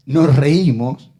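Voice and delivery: ordinary talk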